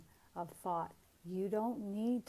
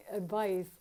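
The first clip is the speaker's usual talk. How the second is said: ordinary delivery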